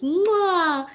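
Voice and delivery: very high imitated baby mwah; imitating baby kiss. Mom imitating her baby's kiss sound. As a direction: copy the baby. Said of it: "but he'll just".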